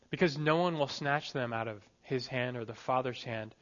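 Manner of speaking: normal talking voice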